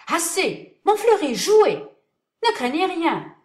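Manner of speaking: high, loud shouts; crowd shouting. Acted. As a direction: yell from the pit.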